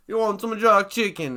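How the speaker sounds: a clumsy Jamaican accent, played for laughs; terrible Jamaican accent. The big Jamaican character's first line in a terrible Jamaican accent. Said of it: "I did a terrible accent there".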